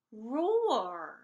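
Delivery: plain reading voice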